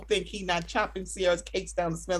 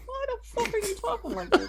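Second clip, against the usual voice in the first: high, softer disbelieving squeak; incredulous squeal; 5 dB quieter than usual. Retold or imitated. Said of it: "I'm like".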